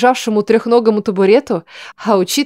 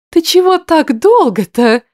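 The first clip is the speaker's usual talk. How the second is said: put-on girl character voice; 8 semitones higher, 3 dB louder, slower than usual. A narrator's reading. Anil asks, voiced character.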